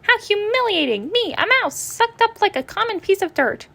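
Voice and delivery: high squeaky outraged mouse voice; indignant mouse. Acted. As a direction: sputter your wounded pride.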